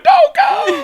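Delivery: very high, loud imitated child's wail; mock crying. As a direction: wail it out, hamming up childhood heartbreak. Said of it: "I cried when he was leaving".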